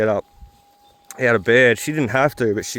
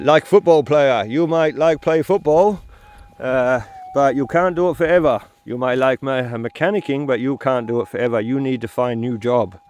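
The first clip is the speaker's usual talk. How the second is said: imitated Chinese accent; 3 semitones higher than usual. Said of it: "um, accent".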